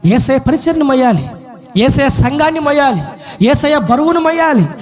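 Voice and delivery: insistent list climbing in pitch to a high peak; preaching crescendo. Real, not acted. Preaching builds to climax. (క్రమంగా స్వరం పెంచుతూ ప్రసంగం)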